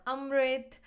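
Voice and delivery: Amrit said as "UM-rit", first vowel short; Indian pronunciation. Shown as a demonstration. Giving viewers a second version of the name, Indian pronunciation. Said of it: "In India, it's called Amrit".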